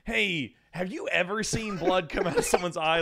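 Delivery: usual speaking voice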